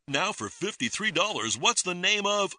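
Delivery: a TV quizmaster asking the next question; game show host. Acted.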